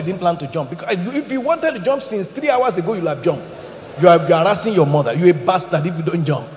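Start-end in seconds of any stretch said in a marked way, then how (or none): 4.0-6.5 fast, loud telling-off; scolding rebuke. Acted. Pastor to the boy on the window, fast loud scolding. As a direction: rattle it off loud like a fed-up pastor.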